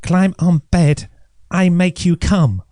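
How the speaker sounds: a hint of the acupuncturist's Chinese accent, unhurried; Chinese accent impression. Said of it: "So she said".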